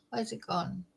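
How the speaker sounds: quiet mutter under breath; muttering to herself. Real, not acted. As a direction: mutter it softly, searching. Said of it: "There's a new thing on StreamYard".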